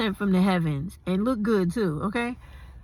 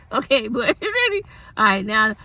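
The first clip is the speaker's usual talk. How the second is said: giggling; 4 semitones higher than usual. Real, not acted. Laughing at herself.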